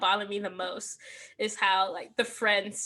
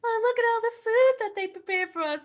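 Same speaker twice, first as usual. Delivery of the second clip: high, bright mock cheer; mock cheerful mom; 11 semitones higher than usual. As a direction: imitate a falsely cheery mom. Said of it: "she was like".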